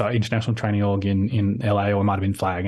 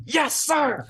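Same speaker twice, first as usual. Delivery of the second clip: high-pitched, over-keen yes-sir; mock-zealous high cry; eager newcomer. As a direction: imitate a gung-ho recruit piping up. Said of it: "this one voice going".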